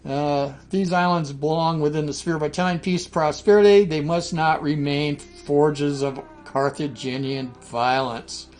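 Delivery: roman orator. Acted. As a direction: declaim it like a Senate decree. Reading Rome's war declaration aloud.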